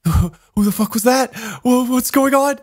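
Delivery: high, stammering panicked questions; retold alarm. Asking friends who that girl was, mock alarmed.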